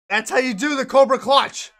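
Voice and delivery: announcer shout. Acted. Wrestling move demo finish.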